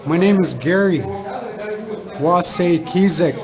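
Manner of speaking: retold slow, loud self-introduction; loud, clear announcement. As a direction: say the name out loud so an old man can hear. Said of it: "I say out loud to him so that he can hear it".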